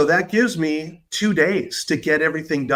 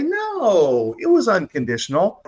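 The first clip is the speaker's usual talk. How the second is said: parents' protesting denial; 5 semitones higher than usual. Acted. Parents answering their child about love, mildly protesting. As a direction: lightly mimic parents insisting otherwise.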